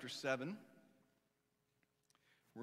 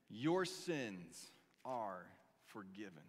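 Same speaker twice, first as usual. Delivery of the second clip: tender reassurance; 6 semitones lower, much slower than usual. Acted. Jesus forgiving the kneeling woman, slow and tender.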